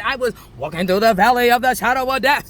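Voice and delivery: loud, sermon-like declaration; declaimed like scripture; psalm quote. Acted. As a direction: proclaim it as your own truth. Repeats psalm line.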